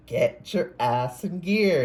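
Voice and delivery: slow, high, loud stern command; specific intonation. Retold or imitated. Reader repeats a spirit guide's command, slow and loud. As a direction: drag out each word like a spirit guide barking orders. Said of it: "with that specific intonation".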